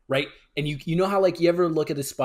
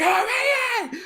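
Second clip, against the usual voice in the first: high, loud startled cry of fright; retold panic when the spider falls; panicked yell; 5 dB louder than usual. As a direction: yelp it out as the spider drops.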